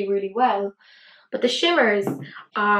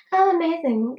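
high, loud, over-the-top gushing; mock gushing; 7 semitones higher, 4 dB louder than usual. Irish woman mocking over-the-top praise, hammed-up gush. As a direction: ham up the gush you'd never say. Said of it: "we don't, like, say, like".

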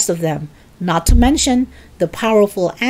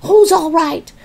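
high, loud outraged retort; indignant yell; 10 semitones higher, 5 dB louder than usual. A narrator's reading. Grandma disputing George's reassurance, yelling. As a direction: yell the question back at them. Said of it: "she yelled".

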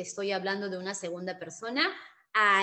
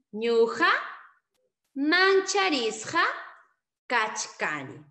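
slow repeat; 3 semitones higher, 5 dB louder, slower than usual. Shown as a demonstration. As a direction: repeat it spaced out for the class.

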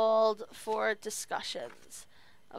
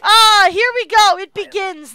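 excited; 7 semitones higher, 15 dB louder than usual. Real, not acted. Excited at new order.

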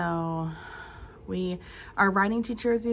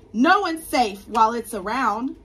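squeaked; 3 semitones higher, 9 dB louder than usual. Acted. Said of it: "squeaked Mouse".

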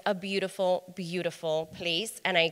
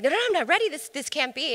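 high, pleading refusal; arguing with the voice of God, high and pleading; panicked protest; 9 semitones higher, 3 dB louder, faster than usual. Retold or imitated. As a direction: push back in a rising panic. Arguing with God's voice.

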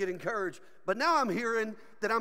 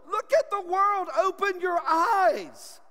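high, loud preaching cry; urgent shout; 7 semitones higher, 5 dB louder, slower than usual. Real, not acted. Preacher urging the congregation to see evil, shouting. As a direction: shout it to wake them up, drawing out the end.

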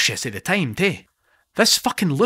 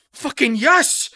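much higher, louder burst of joy; goal celebration; 13 semitones higher, 4 dB louder, much slower than usual. Acted. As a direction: cheer the scrappy goal.

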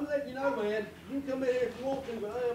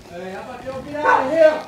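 shouting; 14 dB louder than usual. Real, not acted. Suspect yelling through door.

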